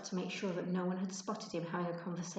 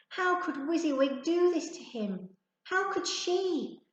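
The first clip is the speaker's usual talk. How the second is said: dismayed; 9 semitones higher, 4 dB louder than usual. Acted. Ben's dismayed thought.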